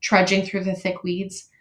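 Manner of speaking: usual speaking voice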